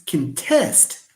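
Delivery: slow "contest" as cun-TEST; stress on second syllable. Shown as a demonstration. Teacher models the verb, "contest" stressed on the end. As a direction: weaken "con" and lean hard on "test" in "contest". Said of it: "when it is a verb".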